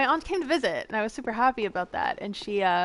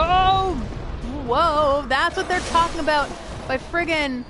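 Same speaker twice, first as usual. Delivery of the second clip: startled; 6 semitones higher, slower than usual. Real, not acted. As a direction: caught off guard by an ambush. Ambushed in the game.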